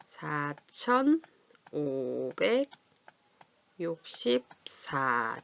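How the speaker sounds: very slow, long gaps between place values; stretched syllables, piece by piece; drawn out while writing. Real, not acted. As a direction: dictate it to yourself while writing. Writing the number while saying it.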